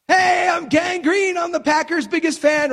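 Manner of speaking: high excited fan shout; fan yelling. Retold or imitated. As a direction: yell it like a painted superfan. He was yelling and screaming.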